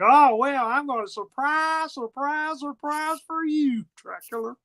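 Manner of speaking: hammed-up gloating surprise; mock taunting. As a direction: draw out each surprise gleefully. Frank taunts Dracula with surprise.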